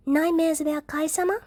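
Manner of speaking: put-on high little-girl voice, hesitant; a small child asking timidly; child Eri, hushed. A narrator's reading. Eri asks June about Kai-sama, high and timid. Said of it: "Eri whispers".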